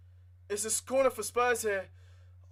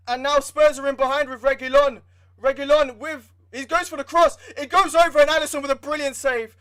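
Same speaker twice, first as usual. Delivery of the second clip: louder, rising live call as the cross comes in; urgent fan play-by-play, louder; excited commentary; 3 semitones higher, 8 dB louder than usual. Real, not acted. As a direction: call the chance breathlessly like a fan on the edge. Tense late attack, live reaction.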